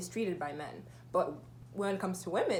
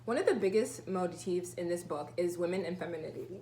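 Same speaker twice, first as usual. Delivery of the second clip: ordinary talk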